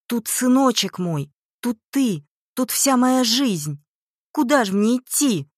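high, loud, desperate protest; desperate cry. A narrator's reading. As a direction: plead at full voice, refusing to leave. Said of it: "закричала Прасковья". (отчаянный крик)

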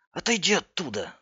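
high-pitched urgent order to get away; alarmed warning. A narrator's reading. Andrei warns his friend away from the wall, alarmed. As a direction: warn a friend off, pitch jumping up.

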